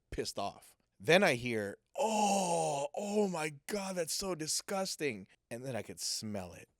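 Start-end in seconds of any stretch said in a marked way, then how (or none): 2.0-5.2 high, drawn-out mock disgust; mock disgusted cry. Bystander sees someone get vomited on, high disgusted cry. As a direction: exclaim in revulsion, much higher in pitch.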